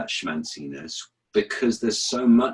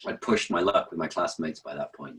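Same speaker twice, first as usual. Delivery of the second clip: ordinary talk, nothing marked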